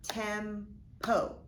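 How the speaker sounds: "Tempo" in two chunks, tem, pause, po; split into syllables. Shown as a demonstration. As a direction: break "Tempo" apart after the m. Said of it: "break this word apart now".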